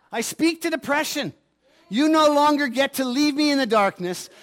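preaching at full force, commanding fear to leave. Acted. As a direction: declare it over fear like a preacher. Preaching at depression.

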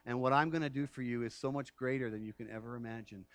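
low, soft, gentle divine reply; low gentle voice of God to Stan; calm voice of God. Acted. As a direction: speak as God, quietly assuring.

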